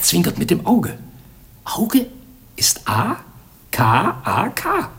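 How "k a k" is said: ordinary talk, nothing marked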